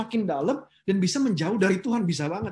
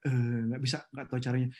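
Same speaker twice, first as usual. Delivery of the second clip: lower, limp and unwilling; mock helpless refusal; 7 semitones lower, 4 dB quieter than usual. Preacher mimics a lazy employee refusing the boss. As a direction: shrug it off like a useless worker.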